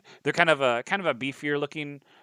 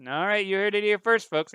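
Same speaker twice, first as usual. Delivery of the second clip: high, loud TV sign-off; reporter sign-off; 9 semitones higher, 6 dB louder than usual. Acted. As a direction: wrap it up like a newsman. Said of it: "And he's like".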